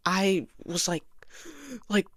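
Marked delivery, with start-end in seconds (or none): 1.9-2.1 sharp high gasp for air; retold panic gasp; gasping breath. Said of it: "breathing a lot".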